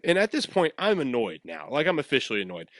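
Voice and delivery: ordinary talk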